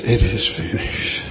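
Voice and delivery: low, spent delivery of a dying man's words; exhausted cry. Retold or imitated. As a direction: say it low, as if worn out and defeated. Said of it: "a cry of exhaustion".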